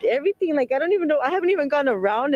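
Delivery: emotional. Real, not acted. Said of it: "I'm very, like, emotional".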